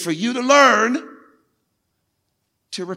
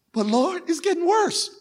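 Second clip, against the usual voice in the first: whining believer; 8 semitones higher than usual. Acted. Believer complaining to God.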